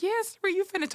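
high, excited yes Ree; cheering. Retold or imitated. Greeting her sister at the finish, high and cheering. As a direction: cheer her across the line.

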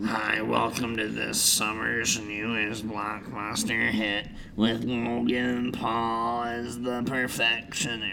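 slow, soft movie trailer voice; trailer announcer. Pretend. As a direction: announce the blockbuster slowly.

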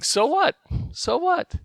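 high, rising "So what?" climbing each time; defiant taunt. Retold or imitated. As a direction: toss it back like a cheeky kid. Kids taunting the bully.

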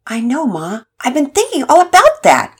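put-on a hopeful kid pleading with his mom; teen son.

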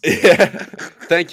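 real laughter spilling into the reply; giggling through a thank-you; laughing. Real, not acted. Laughing at host's joke.

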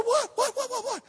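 high, loud, rapid alarmed questions; startled, hurry to her; husband answering his wife's scream, high and alarmed; alarmed shout. Retold or imitated.

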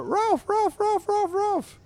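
very high-pitched cartoon dog barks; barking dog. Dog pestering Drabble in his house, high barking. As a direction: yap the words like a small dog. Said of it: "And you got more".

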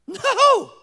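a sudden loud, high shout of No; emphatic no. Real, not acted. Preacher answering his own question with a shout. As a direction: throw the answer out hard. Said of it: "emphatic answer to own question".

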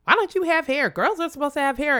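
put-on high, curious child's question; child asking. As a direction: ask innocently like a little boy. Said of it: "little boys".

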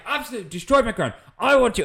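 high, loud mock rant; imitated angry shouting. Landowner shouting about his destroyed ground, imitated high angry rant. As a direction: play a landowner kicking off. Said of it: "he's shouting and kicking off".

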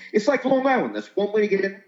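put-on New York accent. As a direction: imitate a New Yorker bragging in a New York accent. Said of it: "a New Yorker at the airport going".

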